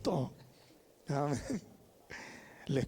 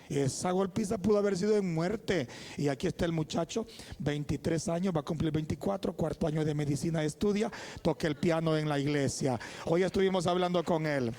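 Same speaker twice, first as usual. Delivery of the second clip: impassioned preaching; 5 dB louder than usual. Real, not acted. Preacher presenting the surviving boy to church, impassioned preaching. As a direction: proclaim it to the whole congregation, building louder. Preaches testimony at full force.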